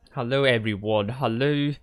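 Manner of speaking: ordinary talk, nothing marked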